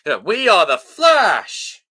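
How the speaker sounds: loud and slow, hyped up; excited shout. Real, not acted. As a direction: shout it like a battle cry.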